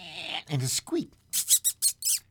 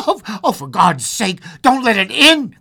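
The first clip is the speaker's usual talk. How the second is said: terrified plea; 11 semitones higher, 14 dB louder than usual. Acted. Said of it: "cried the old man, trembling".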